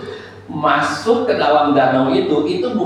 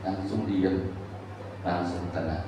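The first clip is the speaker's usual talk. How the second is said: low, very soft telling; quiet, settled and spaced; hushed calm; 8 semitones lower, 11 dB quieter, slower than usual. Shown as a demonstration. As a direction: let the voice drop as the storm stills. Storm obeys, told in hush.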